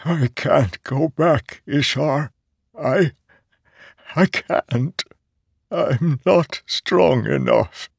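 high, slow, anguished refusal; tearful dread. A narrator's reading. Nale confesses weakness to Ishar, tearful dread. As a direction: plead haltingly, on the edge of tears. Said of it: "blinked sudden tears".

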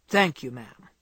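lightly voiced gruff old man, surprising her; gruff old gentleman. A narrator's reading. Old Mister Lawrence thanks Jo from behind, gruff. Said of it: "said a gruff voice behind her".

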